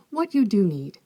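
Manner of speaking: usual speaking voice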